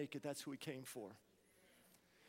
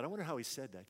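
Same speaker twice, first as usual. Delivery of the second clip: ordinary delivery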